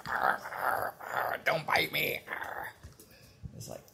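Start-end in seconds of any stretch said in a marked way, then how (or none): 0.1-2.7 whispered growl of a biting candy; candy monster growl. Retold or imitated. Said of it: "it was like".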